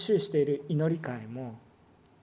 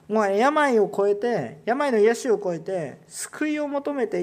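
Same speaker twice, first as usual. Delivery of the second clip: emphatic preaching; 4 semitones higher, 6 dB louder, slower than usual. Acted.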